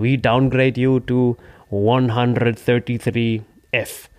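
slow, put-on official voice; deadpan staff. Airline staff announcing the downgrade, slow put-on official voice. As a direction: spell out the bad news slowly. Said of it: "Die so".

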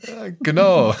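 high giggly laugh. Real, not acted. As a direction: giggle through the words. Laughing at date mixup.

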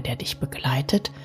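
usual speaking voice

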